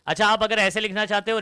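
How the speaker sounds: ordinary talk, nothing marked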